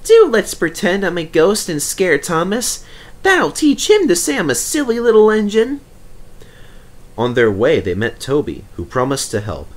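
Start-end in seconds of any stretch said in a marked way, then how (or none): 0.0-5.8 cheeky engine voice. Acted. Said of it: "Percy chuckled".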